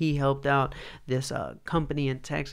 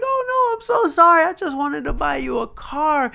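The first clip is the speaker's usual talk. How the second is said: pleading apology; 13 semitones higher, 8 dB louder than usual. Retold or imitated. Apologizing to crying girl.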